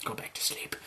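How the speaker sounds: sleepy whisper; drowsy whispered brush-off. Pretend. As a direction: whisper it like someone half asleep. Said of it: "is just like".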